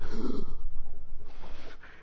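sharp breathless gasp of discovery; excited gasp. Retold or imitated. Preacher recalling finding the connection, an awed gasp. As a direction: gasp like goosebumps just hit. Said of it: "I was like".

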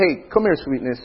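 put-on softly coaxing a crying child; tender. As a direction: soothe her gently. Father soothing crying daughter.

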